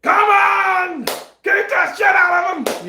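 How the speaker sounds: high, loud ringside yelling; hammed-up frenzied spectator holler; acted fight-crowd shout. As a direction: egg on a fighter at full voice. Acting out crowd cheering the fighter.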